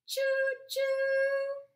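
high, loud choo choo like a steam whistle; train whistle call. A narrator's reading. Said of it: "Papa Pufferbelly cheered".

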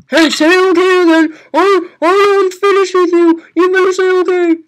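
loud, high bossy shouting; mock scolding. Youtuber scolding the viewer to say okay, loudly. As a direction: boss the viewer around in jest.

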